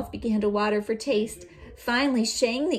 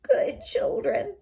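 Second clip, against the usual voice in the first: wolf wheedling; slower than usual. Acted. Wolf begging children.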